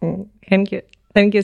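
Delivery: ordinary delivery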